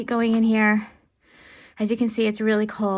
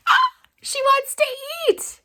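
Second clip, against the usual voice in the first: a sudden high, loud burst of joy; overjoyed cry; 7 dB louder than usual. Real, not acted. Owner seeing her sick hen eat again, shrieking with joy. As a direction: squeal it out in real delight. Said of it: "I'm so happy".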